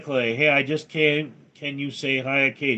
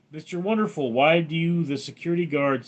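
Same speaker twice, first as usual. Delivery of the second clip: normal talking voice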